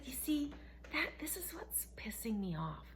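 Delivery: hushed unvoiced whisper to the woman. Telling off the church lady in a whisper. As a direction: lean in and whisper it angrily. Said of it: "I said".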